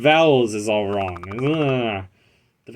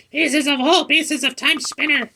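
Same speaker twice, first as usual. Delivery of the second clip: pet voice. Acted. Voicing pet character.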